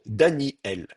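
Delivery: "Daniel" split as Dani-El, air blocked before El; slow "Daniel", with a catch before the final el; glottal stop. Shown as a demonstration. Teacher shows Hebrew name structure, glottal stop in "Daniel". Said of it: "Normalement, ça se prononce Daniel".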